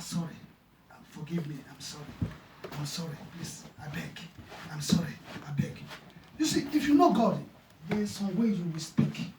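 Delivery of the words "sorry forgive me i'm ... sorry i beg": soft, hushed mock apology; retold pleading. Preacher retelling the man's apology, soft imitated pleading. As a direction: quietly imitate a man begging forgiveness. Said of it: "He now start".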